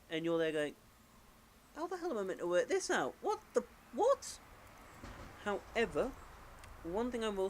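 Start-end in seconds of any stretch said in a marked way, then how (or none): 1.7-4.4 high-pitched, incredulous player outburst; mock bafflement. Player stumped by a sudden hard puzzle, baffled. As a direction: play up total confusion, climbing higher.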